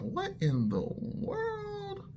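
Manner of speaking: very high, slow, drawn-out What in the world; baffled disbelief. Retold or imitated. Stunned as the tough girl suddenly holds his hand, high and slow. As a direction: stretch it out in stunned confusion.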